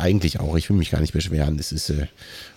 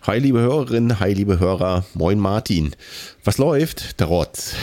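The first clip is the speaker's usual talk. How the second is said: usual speaking voice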